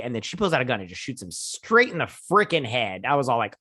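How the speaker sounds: ordinary talk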